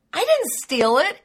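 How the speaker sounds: high, loud retold cry of innocence; startled protest. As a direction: blurt it out, caught off guard.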